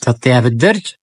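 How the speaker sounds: ordinary talk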